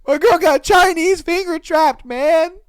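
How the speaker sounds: high, loud, hammed-up crying wail; pretending to cry at home over her; mock wailing. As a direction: howl it like a heartbroken mess. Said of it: "I'd be just like".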